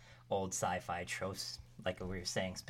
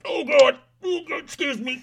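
high, loud choking disgust; retching on awful food; gagging; 14 dB louder than usual. Acted. Guest gags on the soup.

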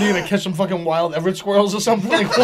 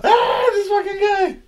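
exasperated shout; 12 semitones higher, slower than usual. Real, not acted. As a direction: shout it out in disbelief. Exasperated laughing outburst.

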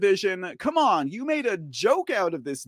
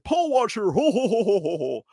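mock jolly laugh; 4 semitones higher than usual. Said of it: "I was like".

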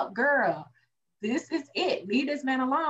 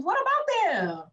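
heated rant; 10 semitones higher than usual. Real, not acted. Heated rant defending Danny.

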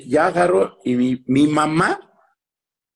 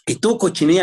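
higher-pitched mock scolding; mock-cross mum, pitched up; scolding mother; 6 semitones higher than usual. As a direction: imitate a mother telling off her kids. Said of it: "siempre nos regañaba".

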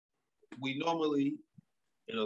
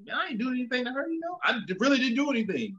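quick, much higher protest of innocence; husband insisting to himself he did nothing, high and quick; retold inner protest; 11 semitones higher, faster than usual. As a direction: rattle off your innocence high and fast.

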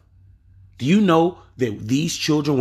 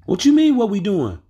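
high, louder mock kid voice; sassy teenage boy; 9 semitones higher, 4 dB louder than usual. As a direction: fake innocence, pitched way up. Boy voiced in the retold story.

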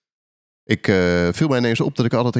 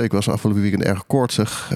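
ordinary talk, nothing marked

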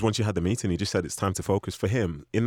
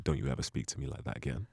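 quiet threat; 5 semitones lower, 8 dB quieter than usual. Retold or imitated. Manager warning the player.

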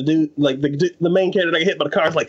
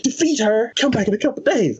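higher, incredulous brush-off; exasperated; 9 semitones higher than usual. Retold or imitated. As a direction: wave him off, fed up. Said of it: "I'm like".

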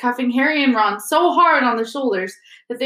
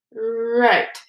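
usual speaking voice

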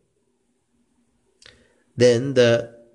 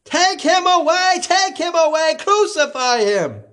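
crowd shouting. A narrator's reading. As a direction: chant it at him. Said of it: "they shouted".

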